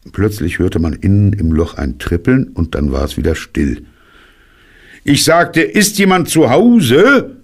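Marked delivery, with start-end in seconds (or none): 5.0-7.4 much higher, louder, drawn-out shout; very loud call. A narrator's reading. Bear repeats his call into the silent hole, very loud. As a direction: insist loudly, stretching the question upward. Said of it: "rief Puh sehr laut".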